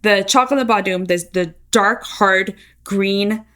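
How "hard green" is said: ordinary delivery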